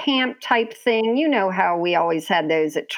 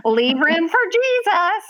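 a half-hearted, joking holler; mock joking yell; 10 semitones higher than usual. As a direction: playfully scold from a higher pitch. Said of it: "yelling ... as a joking tone".

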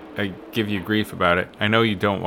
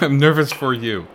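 laughter turning nervous; 3 semitones higher than usual. Real, not acted. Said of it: "nervous laughter".

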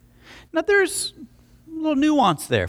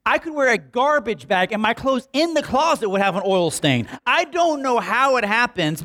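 normal talking voice

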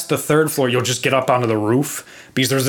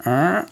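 creaking step noise; 3 semitones higher than usual. Retold or imitated. Boy trying to scare the girl on the stairs, imitated creak. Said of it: "trying to scare the girl".

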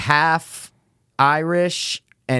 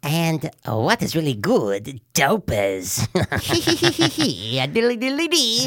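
slow, stretched mock welcome; drawn-out host greeting; 6 semitones higher, much slower than usual. Host welcoming Opers and Dopers in a slow put-on voice.

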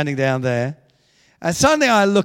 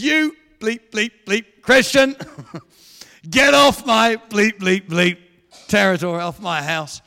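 mock angry rant, louder and higher, easing at the end; livid; 4 semitones higher, 6 dB louder than usual. Livid husband swearing at the doorstep preacher, mock-angry and bleeped. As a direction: imitate a furious man ordering someone off. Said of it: "he is livid with me".

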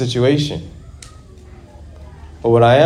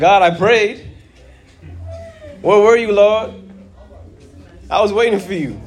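angry prayer; 9 semitones higher than usual. Retold or imitated. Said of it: "you're like".

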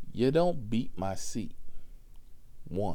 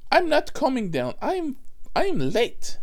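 high, loud panicked shout; mimicked passenger shout; 7 dB louder than usual. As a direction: yell back like the frantic passenger. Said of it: "passenger shouts back".

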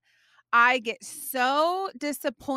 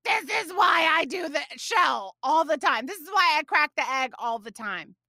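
raised, higher scolding voice; mother imagining scolding her daughter, loud and higher; mock scolding shout; 6 semitones higher, 6 dB louder than usual. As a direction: pretend to snap at the child about the eggs. I could have looked at Haven and said.

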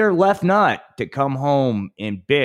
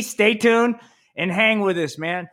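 high hyped stay tuned; excited; 10 semitones higher than usual. Real, not acted. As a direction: sell it like a radio host. Repeated hyped sign-off.

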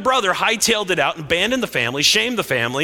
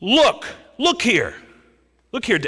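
angry demand; 7 semitones higher, 4 dB louder than usual. Acted. Older son confronting his father, angry. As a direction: plant your feet and demand attention. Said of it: "his statement to his father".

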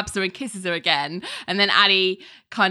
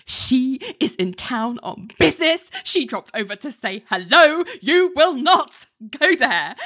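mock hiss; 5 semitones higher than usual. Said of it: "just hisses at her".

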